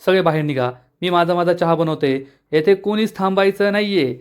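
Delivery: ordinary delivery, nothing marked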